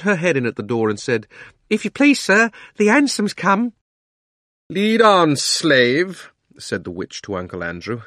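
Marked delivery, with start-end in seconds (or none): 1.7-3.7 high, loud housemaid's announcement; excited housemaid. Acted. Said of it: "having a beautifully exciting morning". 4.7-6.3 loud, high commanding witch; imperious witch. Acted. Witch orders uncle about.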